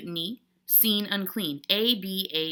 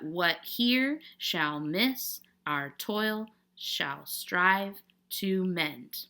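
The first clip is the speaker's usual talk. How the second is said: very slow, measured iambic reading of "What here shall miss, our toil shall strive to mend"; "What here shall miss, our toil shall strive to mend" spaced out in unstressed-stressed beats; scanning the meter; 4 dB quieter, much slower than usual. Shown as a demonstration. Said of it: "Mark the rhythm pattern, both stressed and unstressed".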